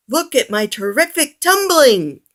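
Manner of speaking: boastful shout. A narrator's reading. Oliver shows off his tumbling with a loud shout. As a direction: brag at the crowd. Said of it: "Oliver shouted".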